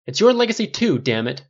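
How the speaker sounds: loud, high-pitched protest; angry outburst. A narrator's reading. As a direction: shout back at the wife in frustration. Father shouts back in acted quarrel.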